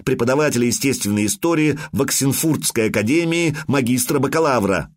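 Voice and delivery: plain reading voice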